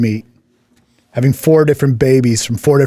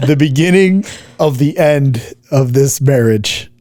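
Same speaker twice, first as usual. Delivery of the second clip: slow, higher, dramatic doom line; ominous announcer; 4 semitones higher, slower than usual. Acted. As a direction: announce it like a movie trailer of doom. Ominous reading of a comment.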